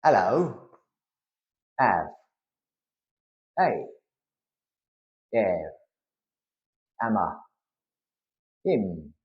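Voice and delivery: Cockney with the H dropped; Cockney accent. Shown as a demonstration. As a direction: say each word Cockney-style, no H at the start. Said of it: "pronounced with a Cockney accent".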